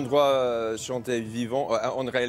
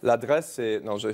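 ordinary delivery

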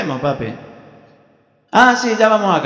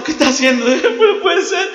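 high, drawn-out disbelieving wail; mock anguish; 12 semitones higher, 3 dB louder than usual. God watching Abraham in distress, high anguished disbelief. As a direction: fret in hammed-up agony. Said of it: "Está sufriendo".

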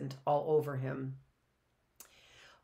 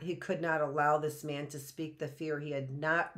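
ordinary delivery, nothing marked

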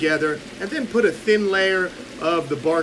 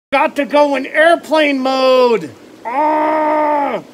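higher and louder, hammed-up cry; mock excited shout; 8 semitones higher, 6 dB louder, much slower than usual. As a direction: blurt it out like a goofy yell. Reading chat, sudden mock outburst.

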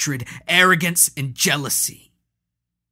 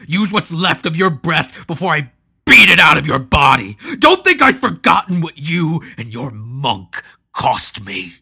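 much louder, hard and higher; menacing threat; 6 semitones higher, 13 dB louder than usual. Acted. Maul threatening the sorceress Caleria, much louder. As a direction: threaten to beat the breath out of her.

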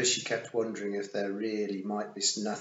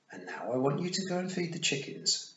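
put-on higher-pitched, patient child-minding voice; big-sisterly instructing; 8 semitones higher than usual. A narrator's reading. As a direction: tell a small child his chore.

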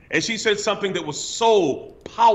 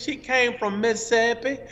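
high-pitched Mississippi twang; Mississippi accent; 6 semitones higher than usual. Retold or imitated. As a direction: imitate a Southern lady from Mississippi. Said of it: "she talked like this".

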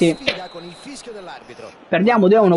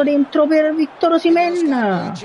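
excited call; 11 semitones higher than usual. Real, not acted. Through ball to striker.

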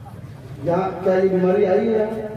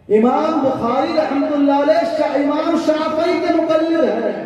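fiery preaching; 5 semitones higher than usual. Acted. Preacher scolding the young Ahl-e-Hadith man, fiery raised preaching. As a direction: hammer the rebuke home.

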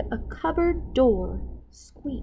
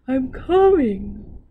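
sleepy grumble; 4 semitones higher, slower than usual. A narrator's reading. Sleepy cook woken by dog.

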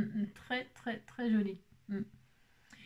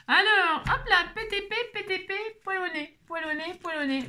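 playful singsong; 9 semitones higher than usual. Pretend. Playful rhyme, high loud.

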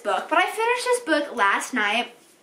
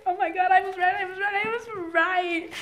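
thrilled to be right; excited triumph; 7 semitones higher than usual. Real, not acted. Guessed the twist correctly.

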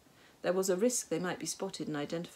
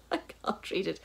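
real breathy laugh through the words; helpless laughing aside; 3 semitones higher than usual. Real, not acted. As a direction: crack up over your own stumble. Reader stumbles and laughs.

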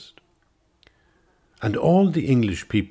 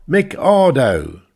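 slow, high, loud sneer; derisive repeat; 9 semitones higher, 9 dB louder, slower than usual. Acted. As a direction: spit the name out with scorn. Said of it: "he repeated with derision".

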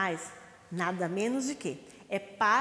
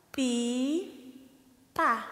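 Pipa split into two slow clapped beats; syllable by syllable. Shown as a demonstration. Teacher counting syllables with claps. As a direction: clap out Pi-pa slowly. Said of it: "Vamos lá, ó".

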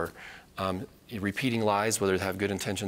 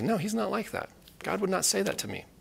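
higher-pitched, firm refusal; firm rebuttal; 10 semitones higher than usual. Acted. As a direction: push back on it, pitched up.

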